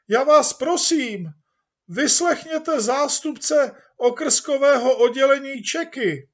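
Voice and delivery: very high, loud pleading shout; chairman shouting. Acted. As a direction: yell to silence the room. Chairman trying to quiet crowd. (předseda zoufale křičí na sál)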